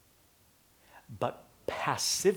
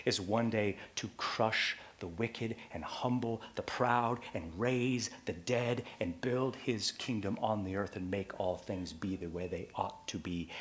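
ordinary talk

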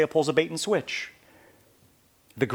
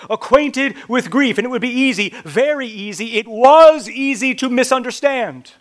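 louder, climbing sermon delivery; rising preaching fervour; 11 semitones higher, 11 dB louder than usual. Acted. Preacher pressing the congregation on Isaiah, climbing intensity. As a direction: build the urgency line by line.

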